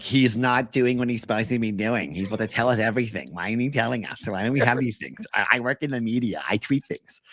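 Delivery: fast, griping reporter voice; whining media member. Acted. Host mimicking media who feel owed news, rushed whine. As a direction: rattle off mock complaints like a whiny journalist. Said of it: "it's a whining media member of".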